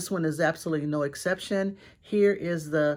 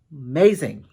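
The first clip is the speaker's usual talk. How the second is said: emphatic amazing; 3 semitones lower, 9 dB louder than usual. Real, not acted. Praising the acrylic horse.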